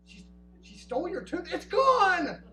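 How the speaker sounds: high, loud disbelief; shocked. Retold or imitated. Dad reacting to the stolen tooth, loud shocked disbelief. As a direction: gasp it out in disbelief.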